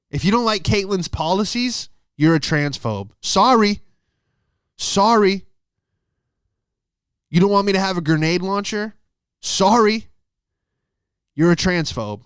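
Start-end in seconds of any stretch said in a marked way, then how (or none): 3.2-10.1 mock apology. Mock apology, high and loud.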